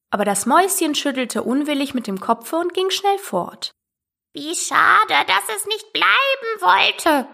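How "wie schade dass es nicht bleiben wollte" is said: high, slow parrot lament; sighing parrot. A narrator's reading. Parrot sorry the mouse left, sighing. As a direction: draw it out regretfully in a put-on bird voice. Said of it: "seufzte der Papagei".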